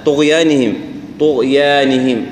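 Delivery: طغيانهم drawn out slowly, alif after ya kept thin; slow correct reading. Shown as a demonstration. Teacher models طغيانهم slowly. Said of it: "الياء إذا مرققة".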